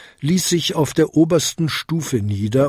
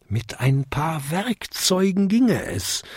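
slow, higher musing tone; pondering aloud; 5 semitones higher, 4 dB quieter than usual. A narrator's reading. Jakob works out how to mend the bell, pondering. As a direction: think it through slowly. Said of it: "grübelte er".

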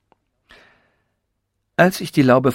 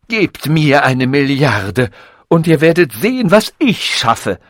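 loud, high, drawn-out boast; grandiose ranting; 5 semitones higher, 6 dB louder, slower than usual. A narrator's reading. Robot boasts it could outdo nature, grandiose fury. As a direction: proclaim it slowly at full voice.

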